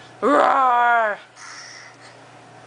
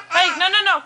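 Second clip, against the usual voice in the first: ordinary delivery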